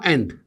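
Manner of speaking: एंड as "end", not "und". Shown as a demonstration. Teacher separating end from and, एंड with "e" vowel. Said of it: "और ये होगा".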